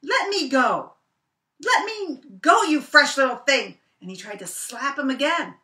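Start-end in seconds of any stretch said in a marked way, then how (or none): 0.0-3.8 much higher, louder frantic rabbit yelling; stuck, angry Brer Rabbit. Acted. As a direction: yank and yell at the sticky baby. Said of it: "He said".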